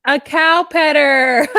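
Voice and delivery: slow, high, disbelieving repeat; a cow petter, drawn out in disbelief; incredulous echo. Real, not acted.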